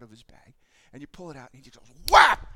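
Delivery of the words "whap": very loud, high shouted whap; a child slapping away dad's hand, voiced; slap sound. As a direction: smack the sound out hard.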